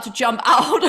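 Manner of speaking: bursting into loud high laughter; laughing. Real, not acted. Laughs at her own point.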